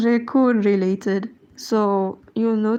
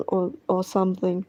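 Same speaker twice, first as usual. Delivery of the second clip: normal talking voice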